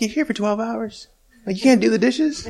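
high, indignant disbelief; incredulous retort. Retold or imitated. Husband scoffing at his wife's request, high and indignant.

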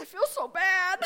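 high, loud imitated child's wail; hammed-up remorse of a confessing daughter; guilt-stricken wail. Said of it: "I've been feeling so bad".

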